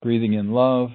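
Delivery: calm, unhurried meditation voice; guided meditation. Acted.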